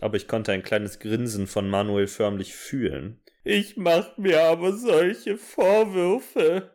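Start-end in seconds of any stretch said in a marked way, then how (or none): 3.4-6.7 high, slow mock sob; hammed-up sobbing. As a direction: drag the line out in fake tears. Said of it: "schluchzte ich".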